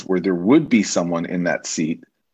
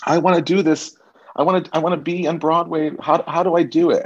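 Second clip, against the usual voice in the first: a touch of high eager fan voice; 8 semitones higher than usual. Retold or imitated. Hopeful fan asking to be on Broadway, lightly eager. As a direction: rush it like a hopeful kid.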